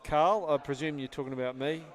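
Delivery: ordinary delivery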